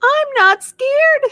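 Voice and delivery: high, slow squeaky duck voice; tiny duckling insisting it's brave; squeaky little duck. Acted. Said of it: "one little duck squeaks".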